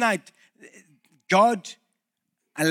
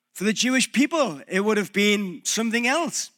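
normal talking voice

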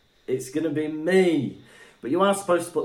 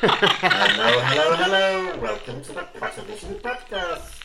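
mock podcast intro through effect; 5 semitones higher than usual. Shown as a demonstration. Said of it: "I sound like I'm from space".

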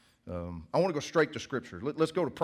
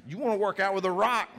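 excited point; 8 semitones higher, 5 dB louder than usual. Real, not acted. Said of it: "preacher repeating his point".

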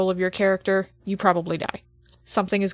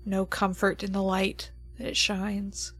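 game master scene setting; 4 dB quieter than usual. Acted. GM sets the opening scene.

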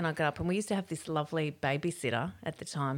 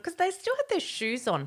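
ordinary talk, nothing marked

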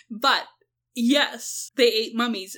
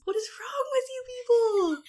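exasperated; 12 semitones higher, 3 dB quieter, faster than usual. Real, not acted. Exasperated at mummy unwrappers.

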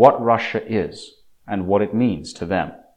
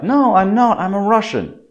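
put-on very high, insistent child retort; angry little girl; 12 semitones higher, 4 dB louder than usual. Girl angry at mother saying she's Buryat, high insistent. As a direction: snap back like an upset small girl. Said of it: "She gets very angry with her mother".

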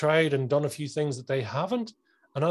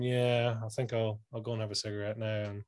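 low, soft, trailing-off mumble; listless; 4 semitones lower, 7 dB quieter than usual. Acted. Kitchen fitter brushing off big ideas, listless. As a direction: let the enthusiasm drain away as it fades.

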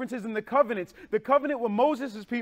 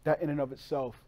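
much lower and softer; soft closing appeal; 9 semitones lower, 6 dB quieter than usual. Real, not acted. Preacher urging the congregation back to faith, hushed. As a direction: bring it down to a hush.

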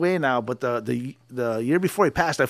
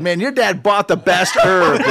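mock hippie dad; 2 semitones higher, 4 dB louder than usual. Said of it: "my father would talk about your dad".

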